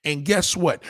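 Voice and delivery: ordinary talk, nothing marked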